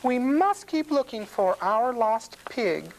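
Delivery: high, urgent housewife voice; farmer's wife. A narrator's reading. Farmer's wife anxious for her lost pig, urgent. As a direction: urge it in a high character voice. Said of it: "says the farmer's wife".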